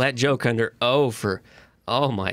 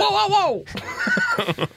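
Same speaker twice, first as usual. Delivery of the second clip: high whoa; slower than usual. Real, not acted. Reacting loudly to impression.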